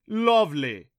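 loud, high villainous jeer. A narrator's reading. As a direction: jeer it with relish. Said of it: "the Rompo jeered".

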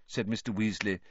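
plain reading voice

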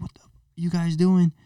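high-pitched retold outrage, held down in volume; mock freaking out. Storyteller replaying his outburst at rock-throwing kids, mock freak-out. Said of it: "freaking out on him".

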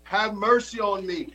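high pleading cry of a blind beggar; crying out for mercy. Retold or imitated. Preacher voicing Bartimaeus calling out to Jesus, high pleading cry. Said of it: "he cried out".

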